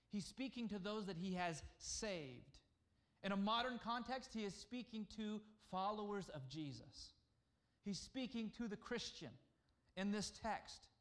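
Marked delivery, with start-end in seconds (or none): none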